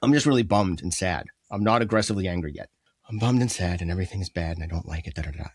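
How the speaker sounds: low, subdued, mildly mopey; depressed. Acted. Friend acting someone depressed before getting advice, low and subdued. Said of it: "let's start with depressed".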